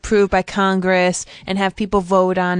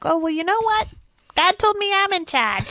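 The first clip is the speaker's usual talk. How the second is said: mock retort; 11 semitones higher than usual. Peasant mocks king back.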